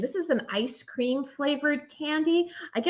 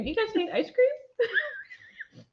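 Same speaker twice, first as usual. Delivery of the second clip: high, slow laughing, crying complaint; laughing whine; 12 semitones higher, much slower than usual. Real, not acted. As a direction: whine it through helpless laughter. Laughing complaint about candy.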